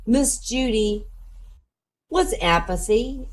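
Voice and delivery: put-on high, slow child's voice; kid asking. Kid asking the teacher a question, high slow voice. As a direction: ask slowly as a schoolkid, high-pitched. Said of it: "some kid says to her".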